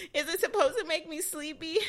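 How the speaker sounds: high-pitched, whiny-worried question; frustrated questioning. Retold or imitated. Patient questioning anesthesiologist about meds, acted frustration. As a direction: ask it high, acting out frustration. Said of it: "I'm getting frustrated".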